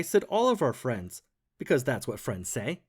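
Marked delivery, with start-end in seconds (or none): none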